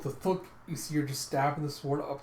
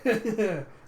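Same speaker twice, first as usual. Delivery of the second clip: high, loud put-on laugh; imitated cocky laughing; acted chuckle; 8 semitones higher, 7 dB louder than usual. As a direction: laugh it off mockingly in character. Acts out Arthur laughing.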